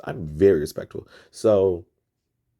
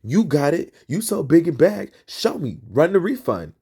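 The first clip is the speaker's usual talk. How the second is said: loud, higher taunting challenge; mock yell daring her to go ahead; retold loud taunt; 6 semitones higher, 4 dB louder than usual. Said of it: "Loud as fuck".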